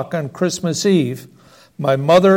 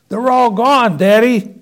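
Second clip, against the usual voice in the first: higher excited shout; 6 semitones higher than usual. Acted. Child shouting to father from the shop window, higher. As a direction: call back eagerly from the window. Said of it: "I shouted".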